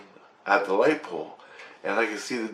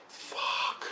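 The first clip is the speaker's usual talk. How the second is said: whispered, breath only; awed whispered curse; 12 dB quieter than usual. Real, not acted. Storyteller stunned by the remembered vision, whispered curse. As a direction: swear under your breath, stunned.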